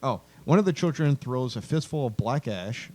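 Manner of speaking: ordinary talk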